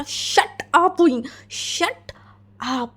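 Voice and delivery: angry shout. Acted. Husband shouts at Wing to stop, angrily. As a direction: cut him off with a yell. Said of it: "एकदम चिल्ला के बोला".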